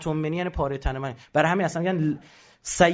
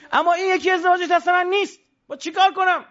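pleading helplessness; 12 semitones higher, 4 dB louder than usual. Retold or imitated. Prophet voiced helpless about marriage.

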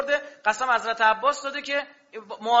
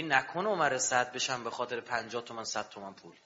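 fearful inner whisper; 11 semitones lower, 9 dB quieter than usual. Retold or imitated. Fearful inner thought, trembling.

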